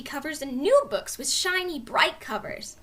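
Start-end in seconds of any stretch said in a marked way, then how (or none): none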